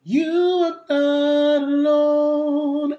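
fuller natural tone; neutral larynx. Shown as a demonstration. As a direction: sing it in your talking voice. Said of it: "it sounds a bit fuller".

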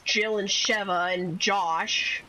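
ordinary talk, nothing marked

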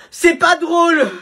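high, slow, loud outburst; it's not funny, shouted high and slow; angry shout. Acted. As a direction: yell it out, offended. Angry retort.

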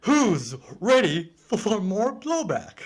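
slow, lifted Shakespearean stage delivery; Shakespearean accent. Shown as a demonstration. Demonstrating the accent for a co-host, hammy Shakespearean. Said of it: "like a Shakespearean accent".

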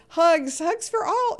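high, loud happy giving out of hugs; gushing hugs. Real, not acted. Streamer sending hugs to the whole chat, high gushing call.

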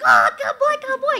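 imitating an excited Mongolian man recognizing Texas; excited Mongolian horseman. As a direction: mimic the thrilled old man hearing Texas. Mongolian man retold again.

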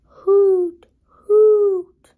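high, loud hooting owl. Acted. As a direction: call out like an owl.

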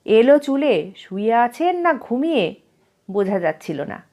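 usual speaking voice

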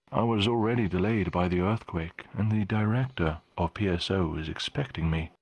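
normal talking voice

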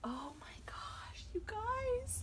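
slow whispered awe rising high; overcome awe. Real, not acted. Overcome on seeing the doll.

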